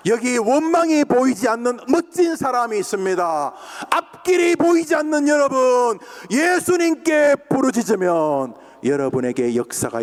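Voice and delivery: full-force preaching. Acted. Preacher exhorts the congregation, loud fervent preaching. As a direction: proclaim it to the whole hall.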